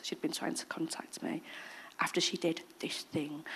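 soft whisper; quiet confidence; hushed aside. Real, not acted. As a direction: drop to a whisper. Hushed aside about the woman.

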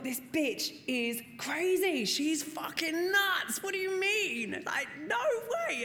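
high, slow disbelieving rant; incredulous. Retold or imitated. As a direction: squeak out mock outrage. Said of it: "And I thought".

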